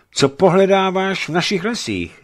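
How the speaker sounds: high, loud stern demand; old man sternly questioning a stranger. Acted. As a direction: challenge the intruder sternly. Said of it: "zeptal se a přísně".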